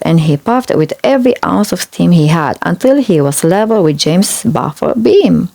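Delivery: plain reading voice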